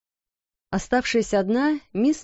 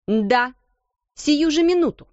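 sharp, high stern command; 6 semitones higher, 4 dB louder than usual. Acted. Headmistress orders her sister now, sternly. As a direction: snap it out as an order. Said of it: "сурово ответила".

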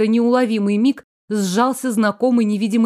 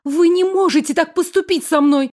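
panicked plea; 7 semitones higher than usual. A narrator's reading. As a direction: beg in panic. Caroline panics at punishment.

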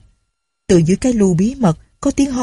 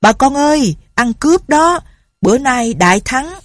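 much higher triumphant call; calling out loud; 7 semitones higher than usual. A narrator's reading. Soldiers announcing the robber to villagers, loud high call. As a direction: shout the victory to the village. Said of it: "nói to".

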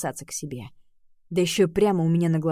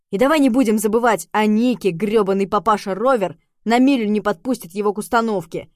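angry shouting; 3 semitones higher, 7 dB louder than usual. Acted. Rem rants at Oliver.

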